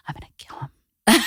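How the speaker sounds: secretive whispered threat; all breath, no voice; whispered in her ear. Retold or imitated. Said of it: "saying it in her ear".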